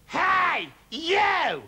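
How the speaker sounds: high, loud yell; grumpy grandma yelling down from the roof. A narrator's reading. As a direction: bellow up from the rooftop. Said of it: "she yelled".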